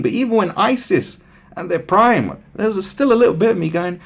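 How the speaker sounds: normal talking voice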